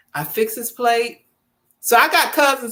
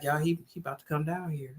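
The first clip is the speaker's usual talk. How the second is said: much softer and fast; hushed warning; 6 semitones lower, 14 dB quieter, faster than usual. Retold or imitated. Warning her family the husband is coming, hushed. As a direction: hurry the warning out softly. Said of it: "And then I be like".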